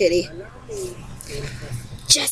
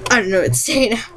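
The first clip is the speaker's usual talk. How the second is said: ordinary talk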